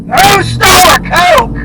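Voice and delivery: high, loud, slow furious shout; boss freaking out. Retold or imitated. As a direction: bellow it across the shop. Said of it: "he freaked the fuck out".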